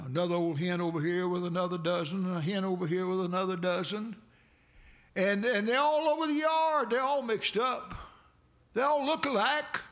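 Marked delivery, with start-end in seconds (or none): 5.1-9.8 excited preaching. Acted. Excited preaching about chickens.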